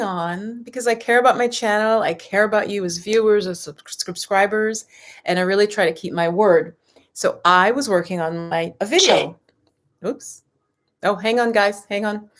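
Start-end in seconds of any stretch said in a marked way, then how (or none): none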